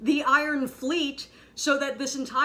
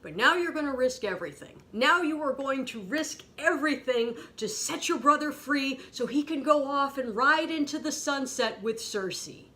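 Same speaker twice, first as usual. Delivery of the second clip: usual speaking voice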